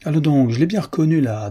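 protestation obstinée, voix nettement montée; entêtement. A narrator's reading. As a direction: contredire avec vivacité et obstination. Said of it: "Treuil s'entêta". (incrédulité têtue, voix aiguë)

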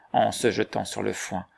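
ordinary delivery

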